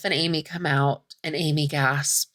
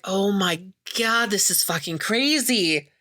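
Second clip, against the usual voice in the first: shocked reaction; 3 semitones higher than usual. Retold or imitated. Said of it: "And you're like".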